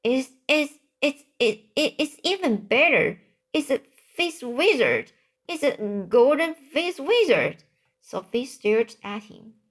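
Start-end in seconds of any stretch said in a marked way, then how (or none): 0.0-7.6 higher-pitched, stammering excitement; excited storybook discovery, voice lifted; thrilled. A narrator's reading. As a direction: read it with mounting thrill, tripping over the first words. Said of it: "with the thrill in his voice".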